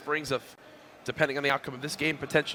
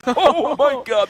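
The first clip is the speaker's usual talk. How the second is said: high, loud laughing exclamation; excited laugh; 12 semitones higher, 11 dB louder, much slower than usual. Real, not acted. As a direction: burst out laughing in disbelief. Shocked at players dancing around.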